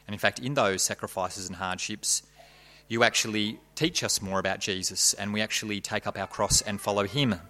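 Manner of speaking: soft, quiet prayer voice; hushed reverent opening prayer. Real, not acted. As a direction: pray humbly and gently.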